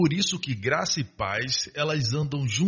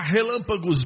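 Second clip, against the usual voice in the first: raised, higher dramatic cry; big theatrical exclamation; mock almighty display; 5 semitones higher than usual. Shown as a demonstration. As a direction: proclaim it like lightning striking. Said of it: "ele não faz assim".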